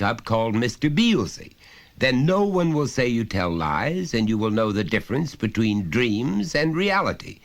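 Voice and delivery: high, loud, lecturing father voice; a father talking down to his son, patiently insistent; stern father. Acted. Father pressing his small son, loud and high.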